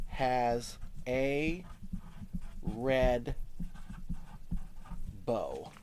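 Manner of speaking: spaced word by word; slow dictation. Shown as a demonstration. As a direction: pause after each word as you write. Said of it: "watch how I do this".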